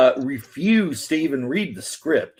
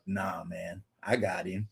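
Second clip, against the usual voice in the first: cool, low murmur; 7 semitones lower, 12 dB quieter than usual. Retold or imitated. The cool sniper replies calmly.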